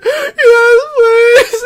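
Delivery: high, crying "yes, please"; teary plea. Retold or imitated. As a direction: beg through tears like a drugged kid. Begging dad while sedated.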